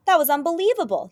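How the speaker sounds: high, loud astonishment; thrilled, punched-up wonder; amazed. Acted. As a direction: exclaim in disbelief. Bob amazed after being silenced.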